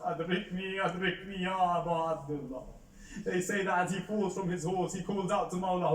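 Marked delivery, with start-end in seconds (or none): 0.0-2.6 crying out. A narrator's reading. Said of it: "he cries out".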